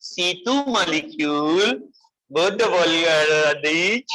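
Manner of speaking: slurred, drawn-out mock misreading; mock wrong version. Shown as a demonstration. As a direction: stretch the words into garbled nonsense. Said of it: "तो ऐसे मत लिख लेना".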